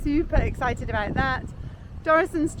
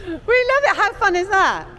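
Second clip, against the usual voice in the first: excited; 8 semitones higher, 8 dB louder than usual. Real, not acted. Excited after echo game.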